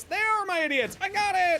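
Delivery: high-pitched excited cry; high, playful exclamation; excited outburst. Real, not acted. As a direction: pitch way up, calling out in a rush of claim. Mock-possessive outburst over in-game units.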